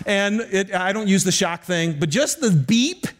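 ordinary delivery

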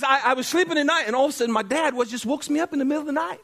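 high, quickened attempt at Irish; bad Irish accent. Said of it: "That's bad. I'm not even gonna try".

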